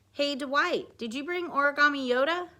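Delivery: lightly acted eager question; kid calling to classmate. A narrator's reading.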